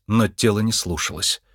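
ordinary delivery, nothing marked

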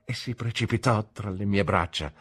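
ordinary delivery, nothing marked

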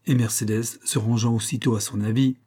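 ordinary delivery, nothing marked

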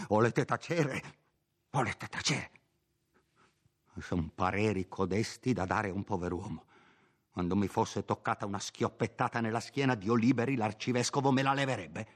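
timid curate snapping at his housekeeper; frightened old priest. Acted. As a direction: shush her in fear of danger. Don Abbondio silences her.

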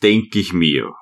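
usual speaking voice

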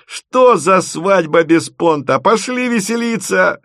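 high, rousing party call; rally them in a high voice; exclaiming. A narrator's reading. Bride calls everyone to celebrate, exclaiming. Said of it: "воскликнула Тося".

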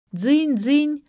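high, loud doorbell imitation; ringing doorbell. A narrator's reading. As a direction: sing out a doorbell's ring. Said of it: "затренькала в прихожей".

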